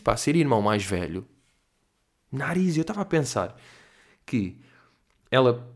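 normal talking voice

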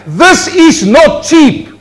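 high, slow, loud emphasis; emphatic shout. Real, not acted. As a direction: drive each word home. Preacher hammers point.

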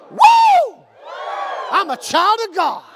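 piercing high whoop, then high proclamation; exuberant declaration. Real, not acted. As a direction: cap the prayer with a rallying wrestler's whoop. Caps prayer with wrestler whoop.

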